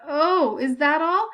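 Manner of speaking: pained sarcastic growl. A narrator's reading. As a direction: bite back at her. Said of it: "Benjamin growled in reply".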